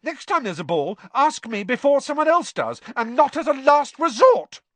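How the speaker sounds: high, loud, building outburst; furious shout. A narrator's reading. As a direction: shout it, climbing to a furious finish. Hermione shouts back in blazing row.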